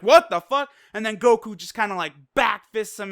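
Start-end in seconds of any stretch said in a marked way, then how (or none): 0.0-0.7 loud, high startled curse; shocked Zamasu. Retold or imitated. Zamasu as Goku appears in front of him, loud startled curse. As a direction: blurt it out, caught off guard. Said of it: "and Zamasu's like".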